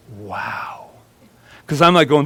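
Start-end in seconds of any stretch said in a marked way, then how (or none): 0.1-0.9 low, hushed awe; retold quiet amazement; awed realisation. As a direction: let it drop softly, as if stunned. Retold moment of stunned realisation.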